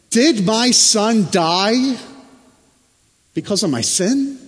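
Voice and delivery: panicked question. Retold or imitated. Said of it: "in a panic".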